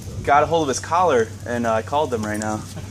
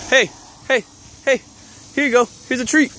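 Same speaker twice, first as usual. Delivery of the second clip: high coaxing treat offer; calling a lost pet; 11 semitones higher than usual. Real, not acted. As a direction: tempt the dog, pitched high.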